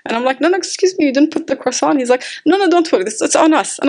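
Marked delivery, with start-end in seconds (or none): 0.4-2.0 higher, startled correction of the bill; politely flag a mistake in surprise; surprised protest. Retold or imitated. Preacher retelling her protest to the waiter, higher startled voice. 2.4-3.8 lightly voiced waiter, higher and friendly; reassuring waiter. Waiter telling the preacher the croissant is free, lightly voiced.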